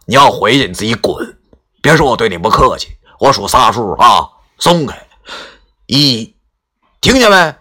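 loud, furious threat building to a count; fierce cursing. Acted. Grandpa grabs Qiao's collar and threatens him, furious and loud. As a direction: snarl it out, ready to fight. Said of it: "狠狠地骂道".

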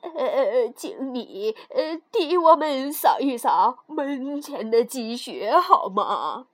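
slow, quavering, hesitant dwarf; hesitant old dwarf. Acted. Dwarfs ask rude girl to sweep snow, slow and hesitant.

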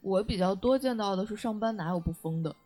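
ordinary delivery

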